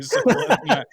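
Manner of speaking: high, loud, laughing through the words; helpless laughter breaking into speech; laughing protest. Real, not acted. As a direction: crack up while joking back. Joking about being in the kitchen.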